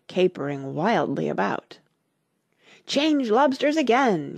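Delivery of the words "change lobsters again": high-pitched narrated yell; mild yell. A narrator's reading. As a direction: yell it high without full volume. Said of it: "yelled the Gryphon at the top".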